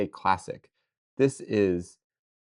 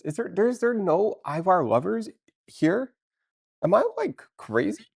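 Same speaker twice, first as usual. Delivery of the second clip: incredulous; 9 semitones higher, 3 dB louder than usual. Real, not acted. Streamer asking chat if nobody loves Ivar, incredulous. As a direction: pitch jumps high in disbelief.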